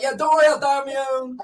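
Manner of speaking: sarcastic. Retold or imitated. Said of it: "I was like".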